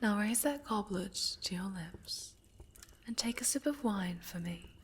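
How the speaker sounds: hushed, coaxing whisper close to the ear; soft whisper. Acted. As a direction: whisper each order like a caress. Coaxing captive to drink.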